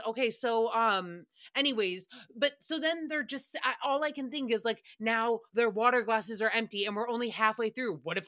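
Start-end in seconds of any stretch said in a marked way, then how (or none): none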